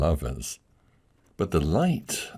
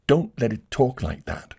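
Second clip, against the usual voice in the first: higher anxious plea; pleading lady; 6 semitones higher than usual. Acted. Lady begs him.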